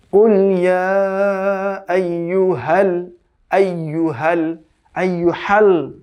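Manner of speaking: slow drawn-out Quranic chant; Quran recitation. Shown as a demonstration. Teacher reciting the verse slowly for students to copy. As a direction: recite it slowly with long vowels. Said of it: "কেমন?".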